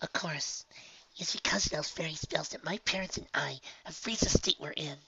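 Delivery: soft breathy whisper, high; shy young filly, hushed; whispery filly voice. Acted. Honeydew continues.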